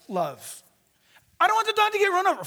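usual speaking voice

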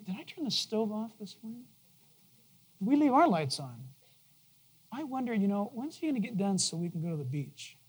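very soft, absent-minded musing; murmured inner thoughts. Acted. Distracted churchgoer wondering during the sermon, murmured softly. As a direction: think aloud under your breath.

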